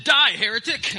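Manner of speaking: high, loud hammed-up yell; mock battle cry. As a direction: shout it like a mock crusader. Punchline of joke, mock outburst.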